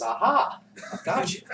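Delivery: high, triumphant "gotcha"; Jesus. Acted. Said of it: "Jesus says".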